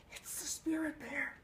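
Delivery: high breathy whisper in awe; hushed amazement. A narrator's reading. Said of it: "Cole whispered louder".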